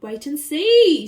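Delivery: loud, slow shout. Acted. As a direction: call it out with glee. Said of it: "shouted the monkey".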